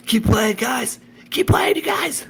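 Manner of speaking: high, loud urgent holler. A narrator's reading. Ziggy hollering to the band over the noise, high urgent holler. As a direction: yell at the band to keep going. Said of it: "hollered Ziggy".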